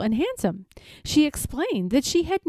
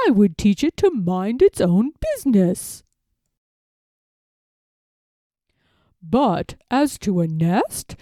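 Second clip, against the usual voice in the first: a fox being oily-polite to a duck; sly fox gentleman; 6 dB louder than usual. Acted. Fox gentleman greeting Jemima, smooth and sly.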